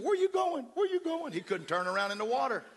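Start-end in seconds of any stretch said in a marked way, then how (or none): 0.0-1.3 high, loud alarmed question; drowning man calling after him; panicked calling. Retold or imitated. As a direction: cry out in fear he's left.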